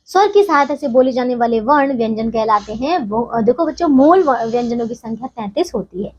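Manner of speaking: ordinary delivery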